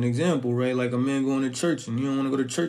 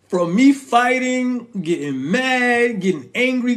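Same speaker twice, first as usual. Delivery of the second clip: heated emphasis; 8 semitones higher, 5 dB louder, slower than usual. Real, not acted. Recalls fighting angrily.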